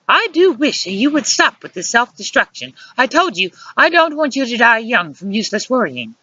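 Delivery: high-pitched scolding woman; snapped. A narrator's reading. Luciana scolds him for self-destruction, snapped. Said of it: "Luciana snapped at me".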